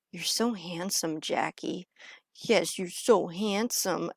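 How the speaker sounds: soft, slow doting mumble; imitated mumbling. Imitating brother petting Jack's tummy, soft slow mumble. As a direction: mimic someone murmuring sweet nothings to a pet. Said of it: "mumbling to himself".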